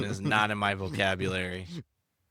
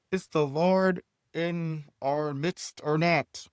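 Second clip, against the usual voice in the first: higher, slow questioning crowd voice; voiced Israelites; 7 semitones higher, slower than usual. Reading the passage, voicing the quarrelling Israelites. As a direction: put on a voice for the doubting Israelites. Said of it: "how I feel about those voices".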